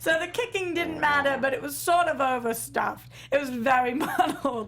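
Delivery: slow, high put-on British accent; mock British actor, slow and amused. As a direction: draw the quote out in hammy British, nearly laughing. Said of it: "can you do a British accent".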